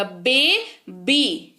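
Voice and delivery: "बे, बी" in two beats, dropping on "बी"; syllable by syllable. Shown as a demonstration. Teacher showing lips joining twice, "बे, बी" split in two. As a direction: separate "बे" from "बी" with a small gap. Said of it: "आपके lips दो बार आपस में join हुए".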